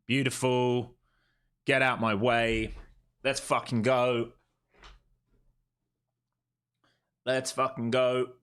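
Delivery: loud, high cheering, slow and stretched; excited shouting. Real, not acted. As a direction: roar it out like your trade just hit. Trader celebrating a winning move.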